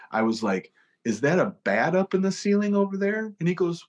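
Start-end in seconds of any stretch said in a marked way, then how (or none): 1.0-3.3 much higher, put-on startled question; mock alarm. Musician tricking his tour manager, mock-startled and high. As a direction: fake a sudden scare to make him look.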